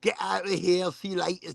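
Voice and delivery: lightly attempted New Zealand accent; a half-hearted try at a New Zealand accent. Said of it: "I can't do a New Zealand accent".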